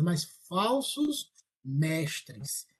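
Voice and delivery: "falsos mestres" drawn out very slowly; slow down hard on "mas falsos mestres"; stress on falsos mestres. Shown as a demonstration. Contrasting the two terms. (ênfase lenta em "falsos mestres")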